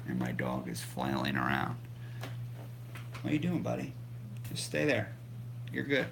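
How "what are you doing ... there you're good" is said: soft to the dog. Real, not acted. Talking softly to his dog.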